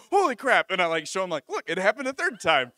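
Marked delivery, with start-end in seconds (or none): 0.1-0.7 high, loud exclamation; acted stunned outburst; astonished shout. Retold shock at more money. 1.5-2.7 high, loud and thrilled; acted excited announcement; excited amazement. Showing friends the money, amazed.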